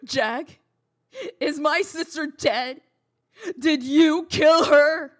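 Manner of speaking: breaking into tears while demanding answers; tearful. Acted. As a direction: sister fearing the worst, sobbing. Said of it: "apologize for the waterworks in there".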